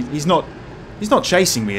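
ordinary talk, nothing marked

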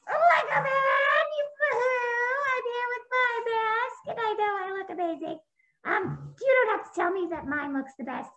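very high, drawn-out boastful voice; very high, vain puppet voice; vain puppet hero. Acted. Lego Man brags.

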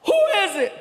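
high shouted who; yell. Retold or imitated. G yelling through the door, high shout. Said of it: "He yells".

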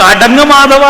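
loud firm self-rebuke; loud self-command. Shown as a demonstration. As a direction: bark it at yourself to calm down. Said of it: "എന്ന് പറയാൻ കഴിയണ്ടേ?".

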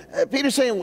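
ordinary talk, nothing marked

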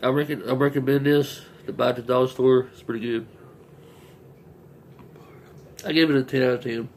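eating a sandwich; talking while chewing. Real, not acted. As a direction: mouth partly full. Seen 2: Bites just before; lips pressed, chewing between phrases while holding sandwich.

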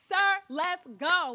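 loud, high, slow command; retold urgent order. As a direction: reenact hurrying him out, loud and drawn out. Acting out ordering husband to leave.

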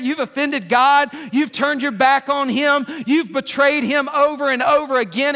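loud, driving self-reminder; self-rebuke. Acted. Preacher reminding himself to forgive, loud and urgent. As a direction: shout the reminder at yourself. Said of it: "I've gotta say".

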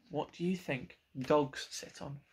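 usual speaking voice

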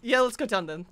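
ordinary talk, nothing marked

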